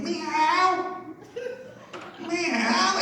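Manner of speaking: high, drawn-out mock meow; cat meow. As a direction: miaow like a cat in a tree. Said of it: "the Scotsman up the tree went".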